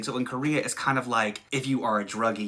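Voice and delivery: ordinary delivery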